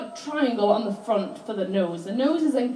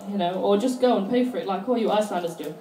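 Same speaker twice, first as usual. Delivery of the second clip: ordinary talk, nothing marked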